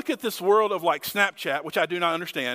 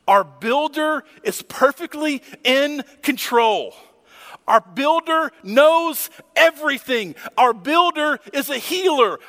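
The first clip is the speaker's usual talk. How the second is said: louder, higher, rising preacher cadence; full-force preaching; 6 semitones higher, 6 dB louder than usual. Acted. Preacher lifting the congregation with a building refrain. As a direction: hammer each line of the refrain home.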